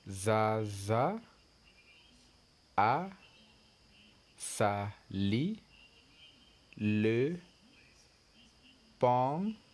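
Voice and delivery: Zaza a sali le pantalon, each word split by a pause; word-by-word reading again. Shown as a demonstration. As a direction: repeat it word by word for the class.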